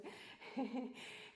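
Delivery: breathless laugh. Real, not acted.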